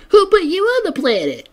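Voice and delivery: very high, loud cartoon squeal; imitating SpongeBob. SpongeBob shouting back at Squidward, in a high cartoon squeal.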